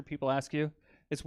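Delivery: ordinary talk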